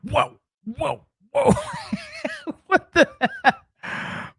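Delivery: high squealing laugh through the words; real laughing. Real, not acted. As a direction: crack up at the replay. Laughing at clip.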